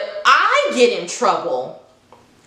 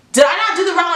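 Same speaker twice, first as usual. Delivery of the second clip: indignant disbelief; 7 semitones higher, faster than usual. Retold or imitated. Protesting to the coach in disbelief. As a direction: sound baffled and wronged.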